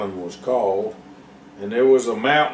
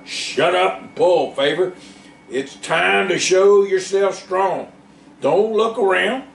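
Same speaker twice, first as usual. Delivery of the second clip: grunted; 8 semitones higher than usual. A narrator's reading. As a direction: push the words out while pulling. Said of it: "grunted Joe".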